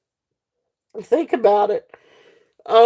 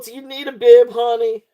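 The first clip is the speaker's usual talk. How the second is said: ordinary talk, nothing marked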